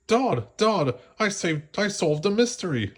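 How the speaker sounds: high, eager little voice; excited puppy. A narrator's reading. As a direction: burst out with excitement. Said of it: "Lucky exclaimed".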